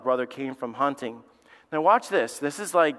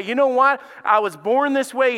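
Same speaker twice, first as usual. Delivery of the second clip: defiant excuse; 8 semitones higher, 5 dB louder than usual. Acted. Imagined confession to God.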